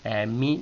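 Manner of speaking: "E, mi" dipping on E and rising on "mi"; dip-and-climb tune on "E, mi"; down then up. Shown as a demonstration. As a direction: drop the E, then lift "mi". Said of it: "baja y sube".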